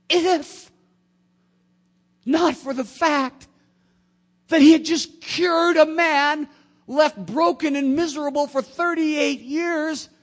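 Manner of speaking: loud, high, slowing preacher's climax; loud and high preacher build; preaching at full force. Acted. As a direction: thunder it from the pulpit.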